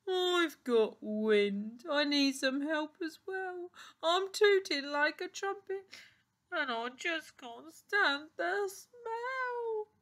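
drawn-out, rising gorilla voice; gorilla complaining loudly. A narrator's reading. Windy Gorilla needs help as well, comic wailing complaint. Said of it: "Gorilla shouted".

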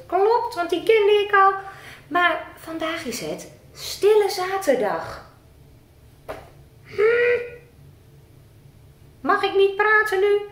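squeaky puppet character. Acted. Puppet Rick greeting the presenter in a high voice.

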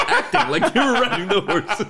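high, laughing through the words; real laughing. Real, not acted. Sharing a favourite film joke with a friend, laughing. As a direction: crack up while telling the joke.

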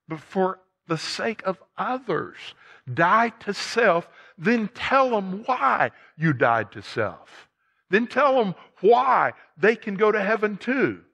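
high, forceful sermon delivery; impassioned preaching. Real, not acted. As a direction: preach it out with rising urgency.

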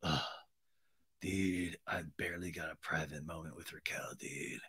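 low, breathy near-whisper; mock-despondent murmur. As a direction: mumble it, utterly defeated. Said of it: "seems despondent".

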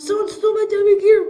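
high, loud mock-distraught cry; outraged wail. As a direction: wail it fast, playing robbed and horrified. Pretend discovery of the theft.

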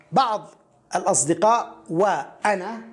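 ordinary talk

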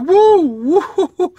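high, loud "woo, woo" of amazement; astonished whooping. Retold or imitated. Reader reacting to a hard-hitting scripture, astonished whooping. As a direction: whoop as if the words just hit you. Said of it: "I was just like".

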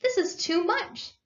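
high, drawn-out exclamation; slow, overcome protest, pitched high; overwhelmed cry. A narrator's reading. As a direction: exclaim as if it's all too much. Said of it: "cried the Mole".

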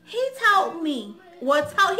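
ordinary talk, nothing marked

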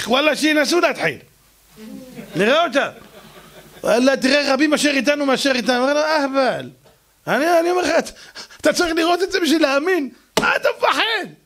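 high excited bravado; boast, suddenly fearless; cocky bravado. Acted. Servant now bold and cocky.

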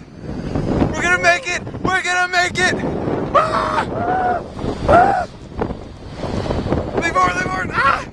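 high excited yelling while racing; excited screaming. Real, not acted. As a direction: scream with thrill on the run. Fast sled run, screaming.

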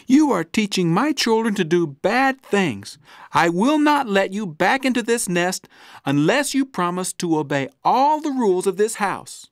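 mother bird sternly warning Stella Luna. Acted. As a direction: lay down the house rules to the bat. Mother bird laying down rules.

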